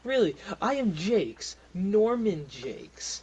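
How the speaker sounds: pompous big shot, drawing out the name; self-important boast. Acted. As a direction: announce yourself with slow pomp. Boastful NPC introduces himself.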